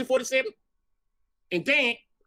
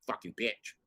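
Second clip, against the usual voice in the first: muttered insult; 12 semitones lower, 10 dB quieter than usual. Retold or imitated. Retold insult to bartender.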